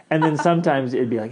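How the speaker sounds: ordinary talk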